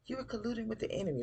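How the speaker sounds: fast, high-pitched accusing quote; imitating an indignant accuser; mock accusation. Acted accusation in recapped quarrel.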